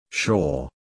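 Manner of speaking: low, doubtful "Sure"; skeptical. Acted. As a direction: drop it low like you doubt him. Doubting son's bold promise.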